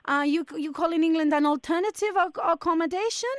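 mock Spanish hotel manager, polite but firm; fake Spanish accent. Prank hotel manager to angry caller, in a fake Spanish accent. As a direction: put on a Spanish accent and keep it courteous.

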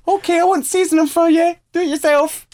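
very high falsetto wife voice; guitarist's wife. Retold or imitated. Wife answering her husband's guitar notes, very high mock voice. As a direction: huff back in a put-on falsetto. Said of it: "She's like".

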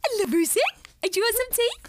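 high, singsong doting-mum voice; exaggerated motherly pet talk; talking to gerbil. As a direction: imitate cooing to a pet like a child. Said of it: "she talks to him in that way".

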